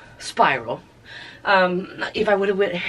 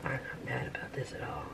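soft whisper; 7 semitones lower, 22 dB quieter than usual. Real, not acted. Whispering to the color.

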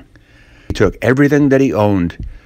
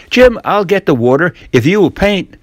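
put-on higher, boyish coaxing voice; young Tom; 8 semitones higher than usual. Tom coaxing Jim to swap chores, sly boyish voice. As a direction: wheedle like a sly boy.

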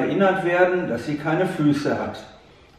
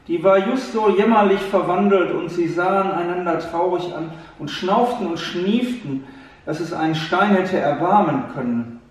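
usual speaking voice

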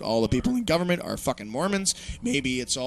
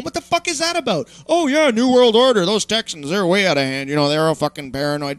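higher, louder sneering parody; mocking dismissive voice; 5 semitones higher, 6 dB louder than usual. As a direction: sneer it like a smug know-it-all. Mocking people dismissing the paranoid.

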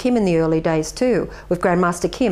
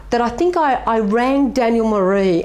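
normal talking voice

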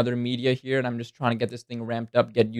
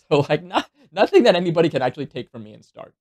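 laughing; 5 semitones higher, 6 dB louder, faster than usual. Real, not acted. Laughing at odd volumes.

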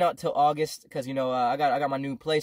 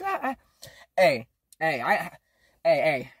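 usual speaking voice

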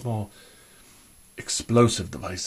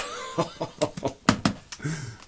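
rippling run of breathy ha-has; drawn-out, tittering laugh; knowing chuckle; 7 semitones higher, 3 dB quieter than usual. Acted. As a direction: chuckle at your own naughty joke. Chuckles at own innuendo.

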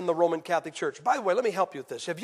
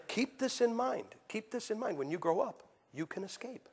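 quiet earnest advice; 8 dB quieter than usual. Retold or imitated. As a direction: lean in and whisper advice. Advising the kid.

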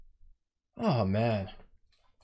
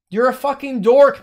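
higher and louder, spitting the insult; angry shout; 7 semitones higher, 11 dB louder than usual. Real, not acted. Streamer insulting a player who trash talked him, angry shout.

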